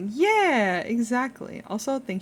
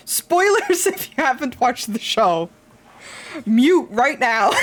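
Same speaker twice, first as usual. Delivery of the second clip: excited call-out; 5 semitones higher, 6 dB louder than usual. Real, not acted. Warning chat, laughing loudly.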